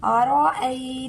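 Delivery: teacher's recitation demo; Quranic recitation. Shown as a demonstration. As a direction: chant अरअयतल्लज़ी with elongated vowels. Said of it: "कैसे पढ़ना है?".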